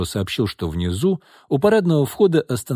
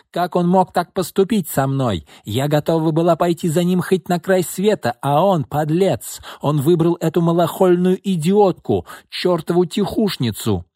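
hysterical shouting; 7 semitones higher than usual. Acted. Jilted sister rants at sister.

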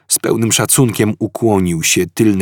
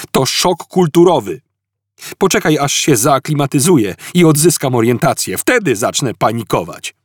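high, loud edgy explanation; Arthur agitated; 6 semitones higher, 4 dB louder than usual. A narrator's reading. Arthur to Ford, edgy high explanation. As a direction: brittle calm over panic.

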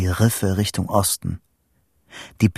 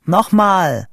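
high, raised call; 9 semitones higher, 5 dB louder than usual. A narrator's reading. Boy answering Vito on the tin phone, calling out. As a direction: call it back down the line.

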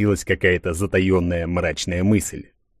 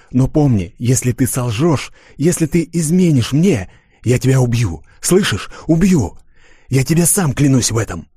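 menacing threat; 5 semitones higher than usual. Acted. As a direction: threaten her with real menace. Threatens to kill if betrayed.

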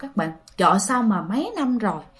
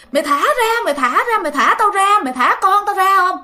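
frantic yelling; 9 semitones higher than usual. Retold or imitated. Mother pulled in by the ghost, high frantic yelling. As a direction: fight off the grip with every word.

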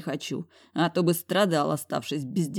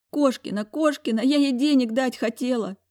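high tearful sobbing words; girl sobbing into his chest; 8 semitones higher than usual. A narrator's reading. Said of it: "всхлипнула Прасковья".

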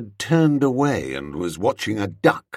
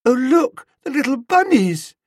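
high, loud, delighted cooing; gushing dreamy woman; 6 dB louder than usual. A narrator's reading. Spotting rabbits in the park, gushing.

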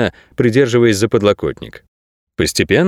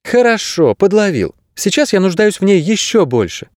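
put-on much higher girl's voice; high-pitched young heroine; voiced girl character; 10 semitones higher than usual. A narrator's reading. Narrator voices the girl Kase.

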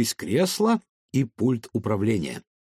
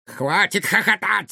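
sharp, higher barked order; commanding; 6 semitones higher than usual. Acted. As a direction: order the machine to stop. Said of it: "скомандовал доктор".

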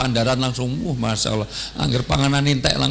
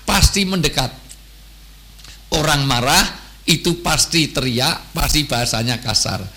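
normal talking voice

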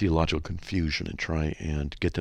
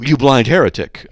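loud insult; 5 semitones higher, 11 dB louder than usual. Pretend. Mock reply to car salesman.

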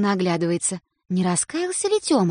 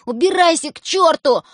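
louder furious yell; boy yelling at sister, louder; angry shout; 7 semitones higher, 6 dB louder than usual. A narrator's reading. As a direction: shove her off with words. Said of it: "и кричит".

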